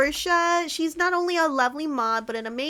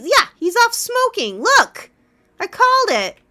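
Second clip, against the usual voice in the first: loud, high-pitched vindication; indignant call-out; 8 semitones higher, 10 dB louder than usual. Real, not acted. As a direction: point it out loudly, proven right. Vindicated, calls out Sebastian.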